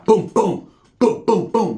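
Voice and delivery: high, loud, punchy booms; imitated punch sounds. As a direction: fire off knockout blows as noises. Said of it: "was knocking them down".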